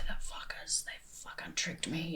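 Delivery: whispered resentment, acting out past anger; retold angry whisper. Recalling feeling tricked by religion, angry whisper. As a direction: seethe it under the breath, slowing down.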